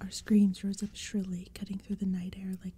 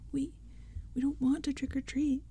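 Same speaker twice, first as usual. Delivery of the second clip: wailing; 6 semitones higher than usual. Acted. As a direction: wail it like a scared kid. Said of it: "Lee wailed".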